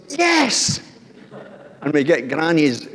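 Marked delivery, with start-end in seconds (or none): 0.1-0.8 put-on high, gleeful yes from a small boy; little boy's thrilled yes; excited child. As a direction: cheer it like a delighted grandson. Grandson cheering at tent idea.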